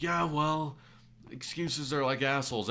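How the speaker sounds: high-pitched mock retort; retorting customer. Customer dismissing the mechanic's excuse, imitated indignation. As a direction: imitate a customer snapping back. Customer snapping back in retold quarrel.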